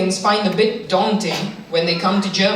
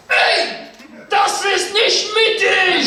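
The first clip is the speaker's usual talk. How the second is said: outraged shout; 10 semitones higher, slower than usual. Retold or imitated. German man at the station yells at a badly parked train, outraged shout. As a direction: bellow it like a scandalised commuter. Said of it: "one German dude just shouts".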